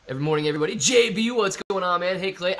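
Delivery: ordinary talk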